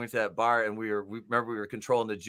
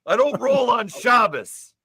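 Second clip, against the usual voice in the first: high, loud, slow indignant movie voice; hammed-up Walter impression; Walter quote; 12 semitones higher, 7 dB louder, slower than usual. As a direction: bellow it like an outraged Walter.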